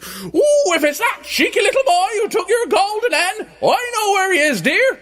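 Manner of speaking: very high, loud falsetto wife; giant's wife. A narrator's reading. Giant's wife tells husband about the boy, shrieky falsetto. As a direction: squawk it in a piercing falsetto.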